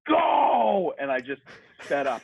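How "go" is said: high, loud shout of Go; a stranger barking Go at a runner; shouted order. Retold or imitated. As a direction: yell it to push him on.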